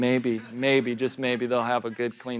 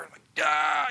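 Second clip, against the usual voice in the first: mock startled yelp. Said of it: "startled by man showering in bathroom".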